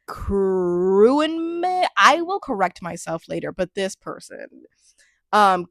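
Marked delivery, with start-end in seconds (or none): 0.1-1.9 "Kruenmin" with a long rolled-out start, rising; unsure pronunciation. Real, not acted. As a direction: stretch the first syllable of "Kruenmin" as a question. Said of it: "I don't even know how to pronounce this".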